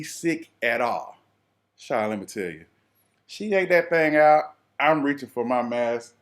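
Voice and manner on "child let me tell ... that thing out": ordinary talk, nothing marked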